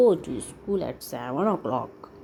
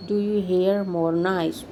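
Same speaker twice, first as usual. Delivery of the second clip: usual speaking voice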